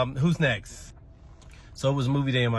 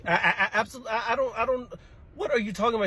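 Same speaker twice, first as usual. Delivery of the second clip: much higher stammering disbelief; shocked denial; 10 semitones higher than usual. Real, not acted. Teacher shocked by a child's slapping claim. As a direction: splutter in disbelief.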